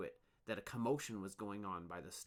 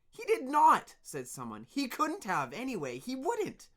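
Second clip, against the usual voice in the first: defending; 11 dB louder than usual. Acted. Workers defend Dune.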